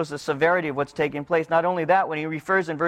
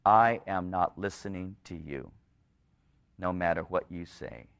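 much lower, softer, final refusal; the Lord refusing; 11 semitones lower, 8 dB quieter than usual. Retold or imitated. The Lord refusing the people, low and final. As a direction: shut the door with quiet finality. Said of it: "The Lord is closing the door and saying".

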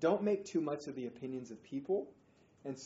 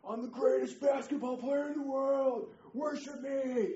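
much higher and louder, a hammed-up bragging star; boastful showboating athlete; 12 semitones higher, 7 dB louder, slower than usual. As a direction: strut it out like the greatest player alive, drawing out the last words slowly. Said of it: "whirls around like".

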